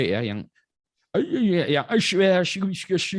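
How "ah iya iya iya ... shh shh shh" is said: hammed-up, higher imitation of a native English speaker's mumbling; mock Western native English. Guest mocks a bule speaker's English for the host, hammed-up. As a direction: parody the slurred sounds of a native English speaker. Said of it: "pronunciation-nya tidak harus kayak orang bule".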